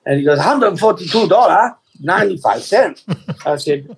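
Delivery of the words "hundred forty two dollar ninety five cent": mock Chinese-accented cashier. Shopkeeper ringing up the total, mock Chinese accent. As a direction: announce it in an imitated Chinese accent. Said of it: "doing my Chinese accent last week".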